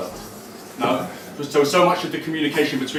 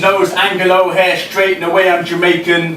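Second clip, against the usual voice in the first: raised, ringing performance poem voice; higher and louder performance reading; spoken word poem; 7 semitones higher, 5 dB louder than usual. Acted. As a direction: deliver each line to the room like a slam poem.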